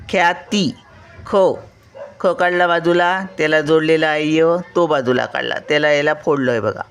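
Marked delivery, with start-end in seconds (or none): none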